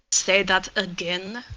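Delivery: menacing. Acted. PC menacing challenge.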